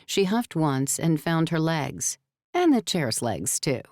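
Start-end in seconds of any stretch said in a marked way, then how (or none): none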